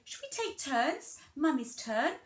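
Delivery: high, sing-song invitation; demonstrated mummy-to-child voice. Shown as a demonstration. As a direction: pitch it up as if to a toddler. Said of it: "if you say".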